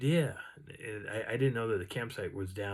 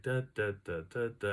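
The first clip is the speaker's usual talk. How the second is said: steady, brisk run of "da"s; quick footstep beat. Retold or imitated. Walker demonstrates their own two-to-one steps, quick beat. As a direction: tap out a walking rhythm in evenly spaced syllables. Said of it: "here I am walking like".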